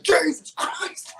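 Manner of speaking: loud, high-pitched, drawn-out exclamation of shock; retold startled shout. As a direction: re-enact yelling in sudden fright. Retells startled shout at bear.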